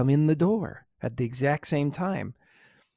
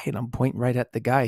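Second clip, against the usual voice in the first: ordinary delivery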